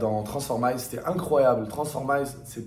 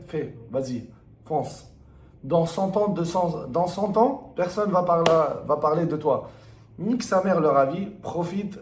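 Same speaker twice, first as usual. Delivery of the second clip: fired up; 5 semitones higher than usual. Real, not acted. Pep talk urging to keep playing.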